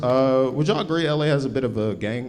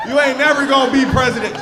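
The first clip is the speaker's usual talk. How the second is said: villain yell; 13 semitones higher, 6 dB louder than usual. Acted. Acted yelling at Kamala.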